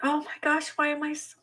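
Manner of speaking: high, soft, choked-up voice; trying to talk through tears; quiet crying. Real, not acted. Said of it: "why am I crying?".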